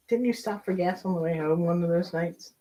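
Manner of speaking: ordinary talk, nothing marked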